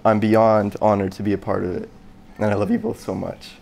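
ordinary talk

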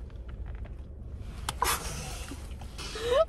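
breathless high wheeze laugh; silent laugh. Real, not acted. Laughs at jab.

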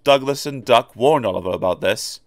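usual speaking voice

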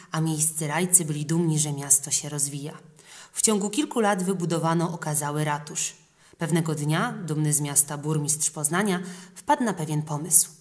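ordinary delivery, nothing marked

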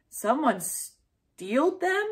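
put-on slow, high little-kid question; little sister worried, slow and high; small child asking. A narrator's reading. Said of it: "said Emmy".

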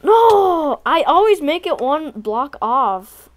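loud, slow frustrated complaint; frustrated shout. Real, not acted. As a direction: cry out in annoyance, drawn out. Fails jump again.

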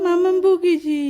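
high, loud mock wail; imitated child's crying. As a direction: wail like a scolded child, playfully. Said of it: "এমন করে কান্না করো". (বাচ্চার নাকি কান্নার নকল)

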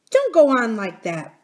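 loud, lifted reprimand; almost fierce. A narrator's reading. Nurse rebuking the miner boy, almost fiercely. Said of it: "almost fiercely".

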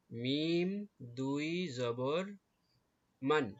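measured letter-by-letter spelling of মান; spelling-out recitation. Shown as a demonstration. Teacher showing pupils how to read two zabar, slow spelling recitation. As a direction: draw out মিম and দুই, then land মান firmly. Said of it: "এইভাবে".

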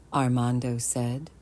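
ordinary delivery

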